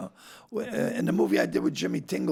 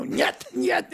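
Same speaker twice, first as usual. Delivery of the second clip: put-on joking Russian accent, higher on the second nyet; bad Russian accent. Actor jokes about his Russian cosmonaut role in a bad Russian accent. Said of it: "I have to have a Russian accent".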